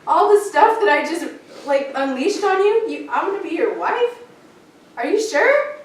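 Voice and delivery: incredulous what, did you hear me; disbelieving what. Retold or imitated. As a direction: ask it like you can't believe it. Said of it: "I'm like".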